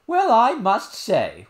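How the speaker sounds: high, loud, slow vexed nurse voice; the nurse scolds in a high, loud drawn-out voice. A narrator's reading. Nurse scolding the crying princess, vexed. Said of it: "now thoroughly vexed with her".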